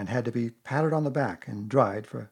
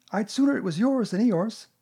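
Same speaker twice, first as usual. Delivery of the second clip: high, squeaky little piglet; 10 semitones higher, 6 dB louder than usual. Acted. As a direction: pipe up in a tiny animal voice. Piglet talks to Pooh.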